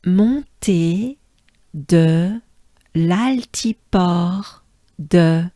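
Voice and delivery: "Montée de l'altiport de Megève" slow, piece by piece; broken down slowly. Shown as a demonstration. Teacher leads learners through the whole name, slowly broken down. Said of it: "it's easy if we break it down".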